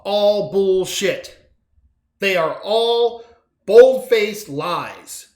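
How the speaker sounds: loud, slow and furious; angry outburst. Real, not acted. Ranting at the governor, loud and furious. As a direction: slam each word down hard. Angry rant against lies.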